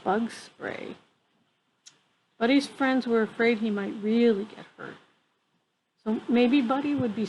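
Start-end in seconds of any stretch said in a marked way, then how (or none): none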